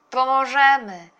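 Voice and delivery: loud, high, drawn-out answer; eager pledge. A narrator's reading. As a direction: answer like keen children together. Children answer eagerly in unison. (głośna, przeciągła, ochocza odpowiedź)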